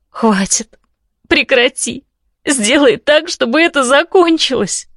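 high anguished cries; anguished screaming. Acted. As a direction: scream it out in despair. Heroine screams in grief.

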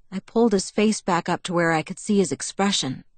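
ordinary delivery

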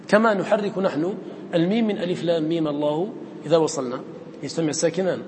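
usual speaking voice

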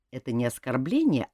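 usual speaking voice

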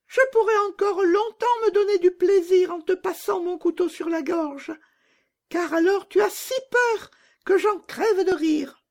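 very high squeaky little bandit; gleefully cruel robber girl. Acted. Robber girl releasing her reindeer, very high and mocking. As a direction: tease and boast in a piercing child voice.

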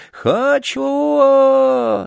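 very high wailing tantrum; screaming spoilt child. A narrator's reading. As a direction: howl like a boy stamping his feet. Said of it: "закатывался, топая ногами".

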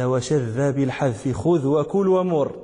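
slow, measured chant of the verse; verse recitation. Retold or imitated. Teacher reciting Ibn Malik's verse to his students. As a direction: recite the poem line deliberately. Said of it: "قال".

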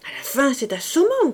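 fed-up sister shouting; exasperated shout. A narrator's reading. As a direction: snap at her brother in exasperation. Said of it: "cria Berthe".

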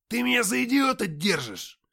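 high, loud enraged shout; furious shout. Acted. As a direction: scream the insult back. Antokha yelling, continues.

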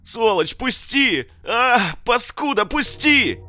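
very high, loud pained screeching; shrieking in pain. Acted. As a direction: howl it, pinned and hurt. Мародёр визжит от боли. (визг от боли)

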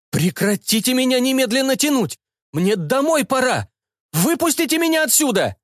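high panicked cries; panicked shouting. A narrator's reading. Cyborg begs students to let go, high panic. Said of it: "закричал он".

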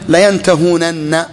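layantahūnanna drawn out syllable by syllable; full underlying form. Shown as a demonstration. Teacher gives students the verb's origin, full form sounded slowly. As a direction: stretch the long ū and sound both nuns, very slowly. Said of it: "أول ما يحذف النون".

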